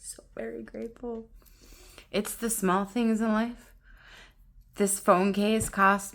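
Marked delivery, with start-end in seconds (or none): 0.0-1.3 hushed, barely voiced thanks; grateful whisper. Real, not acted. Vlogger moved by her new phone case, grateful whisper. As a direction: whisper it softly to yourself.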